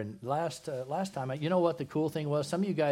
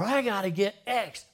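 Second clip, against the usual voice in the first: frantic errand; 8 semitones higher, 5 dB louder than usual. Acted. Acting hurried shopper driving fast.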